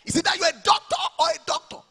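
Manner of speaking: high, loud mock-mother shout; hammed-up Nigerian mother yelling; imitating shouting mother. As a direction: yell it like an outraged mom. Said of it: "My mom didn't agree".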